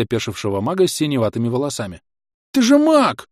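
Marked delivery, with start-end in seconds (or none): 2.5-3.3 high, loud outraged shout; protesting yell. A narrator's reading. Mage yelling in protest at the attacker, high loud shout. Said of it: "протестующе завопил тот".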